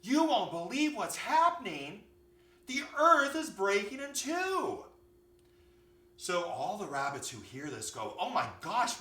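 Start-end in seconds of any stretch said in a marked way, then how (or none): 0.0-4.8 high, loud cry of alarm; frantic storybook bunny, pitched right up; panicked bunny. A narrator's reading. Scared bunny raises the alarm. 8.2-9.0 lightly acted gasp of dismay, raised; mock alarm; alarmed rabbits. A narrator's reading.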